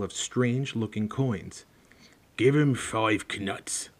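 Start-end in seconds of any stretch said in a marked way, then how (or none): 2.4-3.9 slow, drowsy giant voice; sleepy. Acted. Hagrid tells Harry from the sofa, slow and sleepy. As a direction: mumble half asleep. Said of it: "said Hagrid sleepily".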